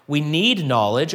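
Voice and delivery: ordinary delivery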